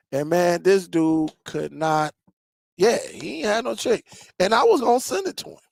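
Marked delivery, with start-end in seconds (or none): none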